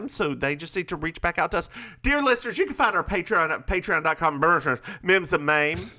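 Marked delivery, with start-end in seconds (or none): 2.0-5.9 high, bright announcer pitch for the plug; ad-read voice. Acted. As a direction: sell it like a host ad. Plugging Patreon to listeners.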